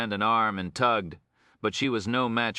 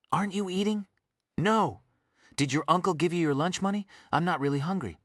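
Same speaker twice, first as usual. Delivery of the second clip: high, different voice for the figures; detective asking the girl about lunch; voiced lunch chat; 11 semitones higher than usual. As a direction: play the worried detective and the girl.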